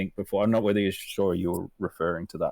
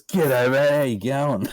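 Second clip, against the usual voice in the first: high, louder cheery g'day; imitating a friendly bloke; 6 semitones higher, 5 dB louder, slower than usual. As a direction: play the oblivious mate, bright and pitched up. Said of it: "he goes".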